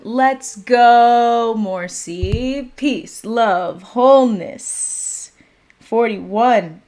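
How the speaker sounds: loud, drawn-out hype intro; hyped-up call. Real, not acted. Host opening the live for viewers, hyped-up call.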